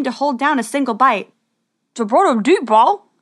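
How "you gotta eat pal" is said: talking through a mouthful of eggs; garbled through a full mouth. Acted. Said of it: "through a mouthful of eggs".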